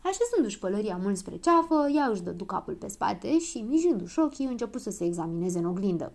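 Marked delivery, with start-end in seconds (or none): none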